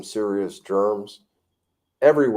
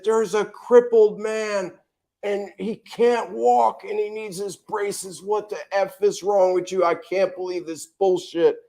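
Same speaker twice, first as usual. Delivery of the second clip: retold screaming; 8 semitones higher than usual. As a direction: rage at the FedEx lady, pitched way up. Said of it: "I started screaming at this lady".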